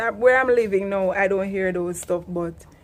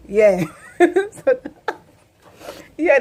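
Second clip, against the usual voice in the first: ordinary talk, nothing marked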